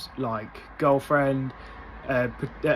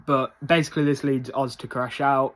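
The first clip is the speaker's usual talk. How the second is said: normal talking voice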